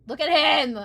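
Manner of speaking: high, loud "look at him!"; excited squeal. Real, not acted. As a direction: gush over the screenshot. Excited by screenshot.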